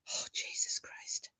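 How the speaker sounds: breathless whispered disbelief; stunned hushed gasp of words; shocked whisper. Real, not acted. Shocked at two bottles.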